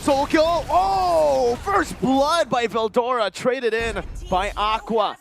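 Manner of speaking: loud, high, drawn-out shout; big hype, easing down toward the end; excited kill call. Real, not acted. Caster calling first blood to viewers, excited shout.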